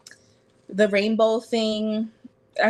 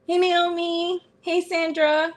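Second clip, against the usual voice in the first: bright greeting; 9 semitones higher, slower than usual. Real, not acted. Greeting viewers by name.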